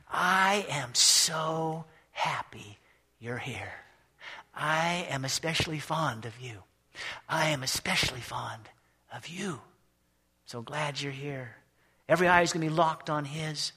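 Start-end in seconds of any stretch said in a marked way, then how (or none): 0.1-11.6 slow, soft, loving Jesus voice; tender Jesus. Acted. Preacher voicing Jesus welcoming the saints, slow and tender. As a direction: speak to each soul slowly and tenderly, welcoming them home. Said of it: "he's gonna say".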